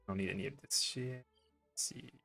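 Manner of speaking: normal talking voice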